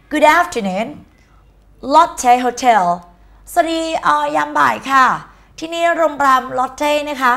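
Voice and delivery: high, singsong hotel receptionist. Acted. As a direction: greet callers in a high front-desk voice. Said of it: "receptionist แปลว่าพนักงานต้อนรับค่ะ".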